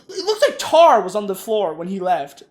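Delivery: ordinary delivery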